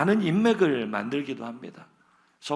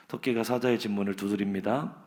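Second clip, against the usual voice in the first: ordinary delivery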